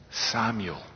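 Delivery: put-on God calling the boy by name, tenderly. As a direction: call his name like a loving whisper.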